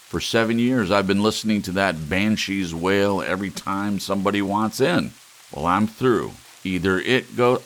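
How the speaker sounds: husband exploding at his wife over the buzzer, angry; angry outburst. Acted. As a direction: let the fury out at the buzzer.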